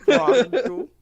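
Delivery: put-on laughing Indian accent. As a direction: mimic an Indian agent through laughs. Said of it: "when you do the Indians".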